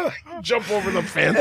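ordinary talk

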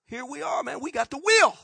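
hammed-up self-congratulation; boastful mimicry. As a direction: crow proudly like a know-it-all. Voices boastful people of past.

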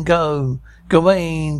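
ordinary talk, nothing marked

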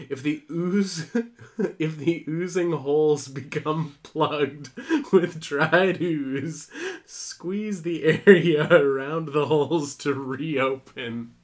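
slow, cracking up while reading; fighting back laughter mid-sentence; laughing. Real, not acted. Laughing through reading instructions.

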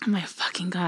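unvoiced embarrassed whisper. Real, not acted. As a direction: breathe it out, mortified. Embarrassed at own story.

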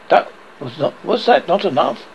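plain reading voice

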